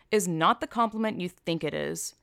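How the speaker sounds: ordinary talk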